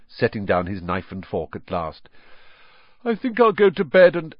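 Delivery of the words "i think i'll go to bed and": higher, louder, breezy bid to escape; hopeful announcement. A narrator's reading. Son trying to slip off to bed, breezily hopeful. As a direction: announce it casually until cut off.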